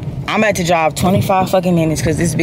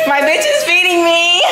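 excited shouting; 14 semitones higher than usual. Real, not acted. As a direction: shriek it with glee. Shouting excitedly while laughing.

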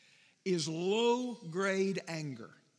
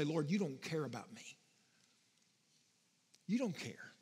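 hurt accusation; 4 semitones lower, 6 dB quieter than usual. Acted. Believers accusing the Lord.

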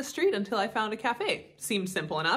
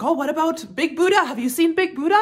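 higher, eager imitation of a Thai accent; mock Thai accent; 7 semitones higher, 3 dB louder than usual. Local man suggesting sights to a tourist, mock Thai accent. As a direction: pitch it up as a friendly Thai local. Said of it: "go into a bit of an accent".

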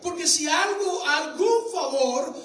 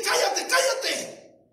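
slow, high shouted hush; demons hushing; 6 semitones higher, much slower than usual. Retold or imitated. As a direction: draw out each cállate like a taunting demon. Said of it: "demonios que vayan a decirle".

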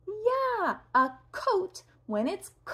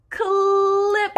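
loud clip; 8 semitones higher, 7 dB louder than usual. Shown as a demonstration. Teacher cues child to say clip, loud. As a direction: Clip called out high and loud. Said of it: "Say clip this time".

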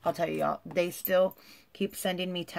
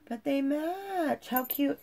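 cooing; 9 semitones higher, slower than usual. Real, not acted. Gushing over matching toes.

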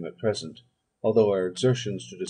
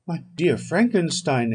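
exclamation; 6 semitones higher, 4 dB louder than usual. A narrator's reading. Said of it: "exclaimed Henry".

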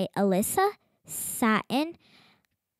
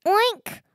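high, squeaky little oink; piglet oink; 7 semitones higher than usual. Acted. Reading the farm story aloud, piglet oinks high and squeaky.

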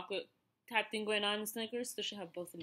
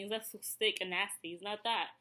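ordinary talk, nothing marked

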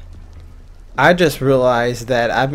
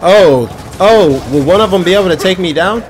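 excited; 6 semitones higher, 5 dB louder than usual. Real, not acted. Enemies rush the player.

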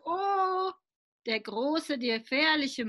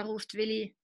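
plain reading voice

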